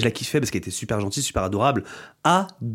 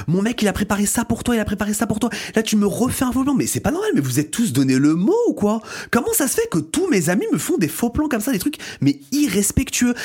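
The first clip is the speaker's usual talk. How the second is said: high-pitched angry outburst, rising as it goes; retold furious rant; 7 semitones higher than usual. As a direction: pitch climbs higher and higher in indignation. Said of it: "j'ai pété un câble".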